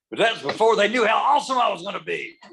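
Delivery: quoting a friend's proud boast, high and loud; proud excited quote. Retold or imitated. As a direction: deliver it like a triumphant punchline. Tim's proud punchline, quoted.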